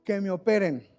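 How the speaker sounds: loud, steady resolve of a grandmother; firm grandmother. Retold or imitated. Grandmother tells her family to operate, loud and firm. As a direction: declare it boldly, ready for surgery. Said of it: "y ella les dice".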